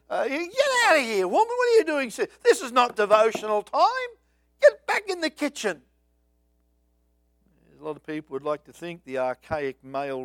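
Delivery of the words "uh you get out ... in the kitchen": mock scolding. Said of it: "Expecting Jesus to look down and say".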